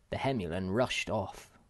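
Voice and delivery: plain reading voice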